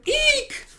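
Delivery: very high, drawn-out eek; acted piercing little eek; fangirl squeal. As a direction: squeal with starstruck delight. Fan squeals after handshake offer.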